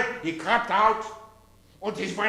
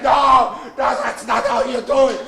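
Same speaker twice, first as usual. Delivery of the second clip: loud mock-German scolding; exasperated protest; 2 semitones higher, 6 dB louder than usual. Humpty scolds the men.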